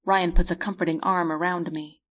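ordinary delivery, nothing marked

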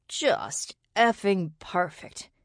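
slow, drawn-out disgust, stress on "effing"; emphatic sarcasm. A narrator's reading. Xander cursing the approaching intruders, bitterly sarcastic. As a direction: spit out "effing perfect" word by word, slowly.

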